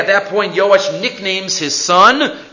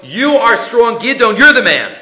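raised, emphatic angel's call; rousing proclamation; 5 semitones higher, 5 dB louder than usual. Acted. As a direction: lift him up with the words. Angel rousing Gidon to lead.

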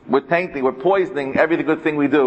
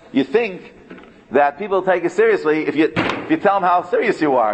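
ordinary delivery

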